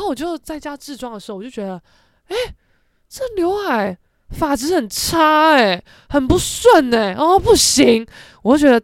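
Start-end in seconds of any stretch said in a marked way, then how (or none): 2.3-8.0 slow, picky griping about the hair; fussy complaint. Retold or imitated. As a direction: drag it out like a fussy perfectionist. Fretting over bad fake bangs.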